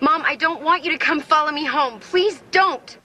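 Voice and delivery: insistent pleading. Acted. Daughter begs mom not to follow her home, insistent pleading. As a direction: plead harder, pitch climbing to the end.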